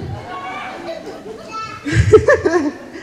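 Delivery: breathy, drawn-out chuckle; laugh. Real, not acted. Comic teasing the audience about babies, amused chuckle. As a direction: chuckle along with the crowd.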